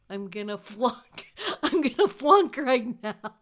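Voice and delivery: mock despair at failing the lab; panicked. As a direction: panic that you'll fail, half laughing. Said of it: "I am basically crying".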